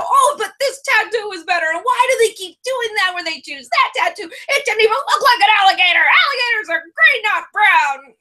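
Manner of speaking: high-pitched, worked-up imitation of an irate viewer; exaggerated retold griping about a TV show; mock angry rant. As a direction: rant indignantly, hamming up someone else's outrage. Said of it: "He was so mad".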